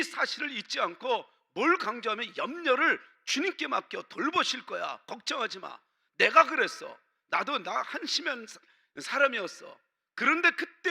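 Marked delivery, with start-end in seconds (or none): none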